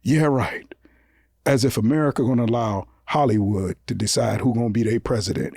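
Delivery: calm scoff, as if it's obvious; dismissive president. Retold or imitated. Bush answering reporters, low dismissive deadpan. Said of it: "he looked at the camera and said".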